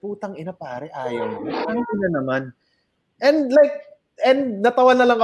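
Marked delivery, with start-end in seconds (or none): 0.0-2.4 low, soft grumbling curse; grumpy stoned guy. Retold or imitated. A hint of an annoyed stoner cursing the comic for refusing the joint. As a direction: mutter it like a sulky stoned man. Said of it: "he got mad".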